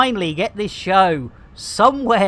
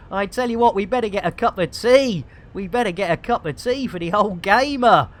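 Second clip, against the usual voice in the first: ordinary delivery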